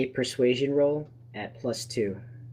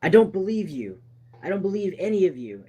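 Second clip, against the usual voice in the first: distrustful gunman; 6 semitones higher, 5 dB louder than usual. Acted. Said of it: "His gun is shaking".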